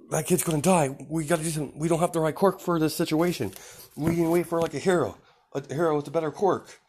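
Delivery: mock panicked hero; panicky bystander. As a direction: voice climbs up in panic.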